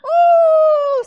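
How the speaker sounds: a high, loud cringing "ooh"; dismayed squeal. Real, not acted. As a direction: let out a big wince of a sound. Reacting to husband's words.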